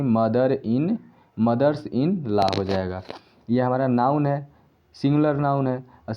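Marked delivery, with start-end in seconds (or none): 0.0-3.0 slow stressed plural. Shown as a demonstration. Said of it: "तो mother में एस लगेगा".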